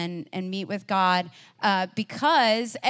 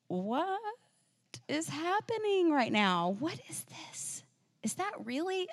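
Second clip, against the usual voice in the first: softer, higher, dropping to a hushed whisper; awed bewilderment; 6 semitones higher, 7 dB quieter than usual. Retold or imitated. Disciples watching Moses and Elijah appear, hushed and astonished. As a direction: murmur in stunned disbelief, a little higher. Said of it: "James and John and Peter are like".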